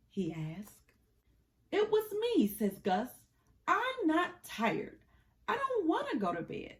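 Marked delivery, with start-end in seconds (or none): 1.7-2.5 much higher, squeaky little Gus. Acted. Gus voiced in bedtime story. 3.7-6.8 much higher, squeaky little Gus. Acted. Gus voiced in bedtime story.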